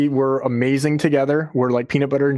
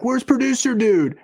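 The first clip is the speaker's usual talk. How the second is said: high, slow hollered call; yelling; 12 semitones higher than usual. Retold or imitated. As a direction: holler it across the river. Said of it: "had the guy on the river yell at you".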